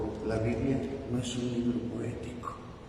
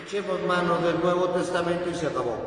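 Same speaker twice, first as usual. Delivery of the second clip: loud, high, driving sermon voice; fervent preaching; 6 semitones higher, 5 dB louder than usual. Acted. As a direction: preach it at full force to the congregation. Priest preaching with rising force.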